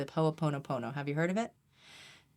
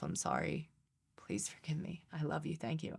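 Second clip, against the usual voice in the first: inner whisper; 9 dB quieter than usual. Shown as a demonstration. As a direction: whisper it inside, barely voiced. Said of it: "I start to say within myself".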